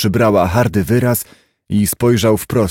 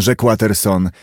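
ordinary delivery

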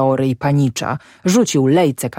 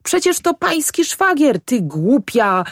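angry yell; 11 semitones higher than usual. A narrator's reading. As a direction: yell across the river at her. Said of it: "wrzasnął Ślimak".

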